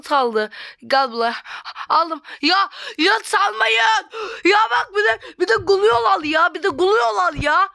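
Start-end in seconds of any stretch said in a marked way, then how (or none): 2.4-7.7 whiny angry cry. Real, not acted. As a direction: wail in frustration that they took it. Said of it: "Bak yine sinirim bozuldu".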